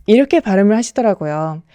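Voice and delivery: ordinary delivery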